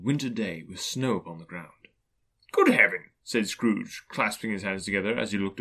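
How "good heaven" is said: loud, astonished cry; startled old man's outburst; amazed exclamation. Acted. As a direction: exclaim in wonder at a sudden sight. Scrooge amazed at his childhood place.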